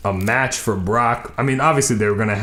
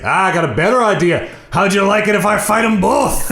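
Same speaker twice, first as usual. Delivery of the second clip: higher, louder hammed-up boast; cocky challenge; 8 semitones higher, 4 dB louder than usual. Host voicing Drew offering to fight both, mock swagger. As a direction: strut it like a bragging champ. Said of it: "And he's like".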